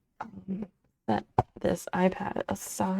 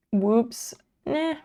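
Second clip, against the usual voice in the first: ordinary talk, nothing marked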